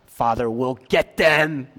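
slow, mock-vengeful Jesus; mock wrong quote. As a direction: drag it out as the wrong version. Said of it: "That's not what he said".

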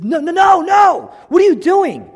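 shouted, high-pitched volley of protest; indignant. Acted. Joseph stopping his blind father's mistake, indignant shout. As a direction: burst out loud and high, outraged. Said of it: "He's indignant".

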